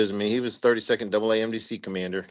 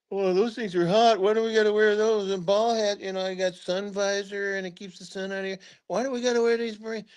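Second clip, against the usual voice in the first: higher, griping soldier voice; whiny complaint; 9 semitones higher than usual. Retold or imitated. The troops gripe about wearing hot berets, a higher whiny imitation. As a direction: mimic the moaning troops, higher.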